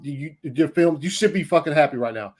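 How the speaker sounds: ordinary talk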